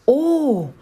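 high startled gasp of oh. A narrator's reading. Scared raccoon caught by the cat, startled oh. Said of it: "hauchte Rudi".

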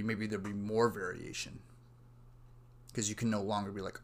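usual speaking voice

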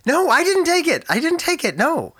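startled high-pitched denial; high defensive denial. Real, not acted. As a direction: squeak out the denial in alarm. Denying to angry neighbor.

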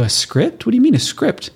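sharp rising question, high on mean; confused repeat of script; puzzled friend. Retold or imitated. Friend in the office chair asks, puzzled and high. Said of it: "They go".

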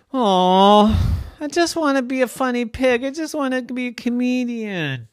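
high, whiny little pig voice; sad Dora. Acted. Dora telling friends her wish, mildly whiny. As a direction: whine it, longing.

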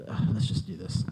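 usual speaking voice

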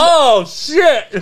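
sudden loud high yelp; shocked disgust. Real, not acted. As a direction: blurt it out after a horrible taste. Reacting to the awful taste.

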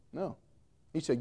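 usual speaking voice

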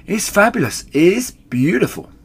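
high, loud rapture; breath-fighting rapture. A narrator's reading. Mister Fox overcome by cider, high breathless rapture. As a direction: exclaim it, overcome by the drink. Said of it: "fighting for breath".

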